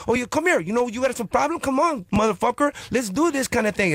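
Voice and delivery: very high, taunting fight talk; high egging-on girlfriend. Retold or imitated. Girlfriend goading her boyfriend into a fight, high and taunting. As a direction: goad the guy into fighting.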